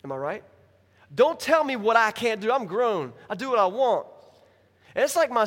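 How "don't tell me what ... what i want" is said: loud, higher-pitched defiant retort; indignant grown-up refusing orders. Acted. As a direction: push back hard, like nobody can boss you. Acting out defiant reply to God.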